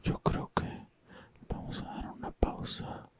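hushed, whispered aside to listeners; mock-solemn whisper. After scary story, announcing a pause, mock-solemn whisper. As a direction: whisper it with laughter threatening to break in.

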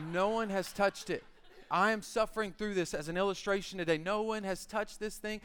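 normal talking voice

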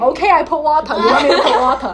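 loud, brusque Hong Kong English; grumpy cook with a Hong Kong accent. Shown as a demonstration. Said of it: "I do Hong Kong".

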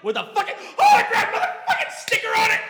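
high, loud shouted rant; mock-outraged shout. Real, not acted. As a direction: yell it in laughing disbelief. Mock outrage about hat stickers.

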